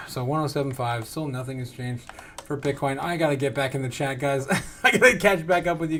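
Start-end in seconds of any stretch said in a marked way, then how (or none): none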